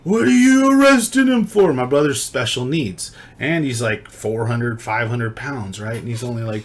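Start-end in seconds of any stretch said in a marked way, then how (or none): 0.0-1.7 high, loud outraged yell imitating an angry brother; furious mock shout at the cops; hella pissed. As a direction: act out an irate protest, pitched way up and loud. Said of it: "my brother came out hella pissed".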